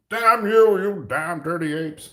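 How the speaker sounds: over-the-top Planet of the Apes cry; Charlton Heston impression. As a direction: ham up a furious movie hero cursing. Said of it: "Is that Charlton Heston?".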